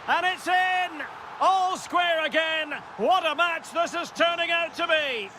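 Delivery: high-pitched, thrilled match commentary; excited goal call. Real, not acted. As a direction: celebrate the equaliser with a soaring voice. Commentator calls the equalising goal.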